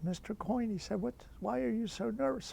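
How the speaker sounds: imitating the priest. Priest questioning nervous student.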